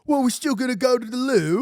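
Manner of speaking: high, loud British protest; hammed-up British accent, pitched high. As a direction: play an incredulous Brit. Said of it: "we won't even speak like this".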